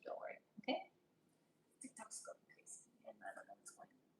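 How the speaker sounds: unvoiced, hushed and hurried; whispered sign-off. Real, not acted. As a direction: whisper it before you go. App glitching, hurried goodbye.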